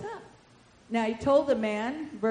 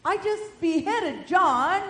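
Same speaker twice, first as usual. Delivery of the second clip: a hint of high, slow king's voice; troubled Herod; 8 semitones higher, 5 dB louder, slower than usual. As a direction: puzzle aloud like the worried king. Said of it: "He said".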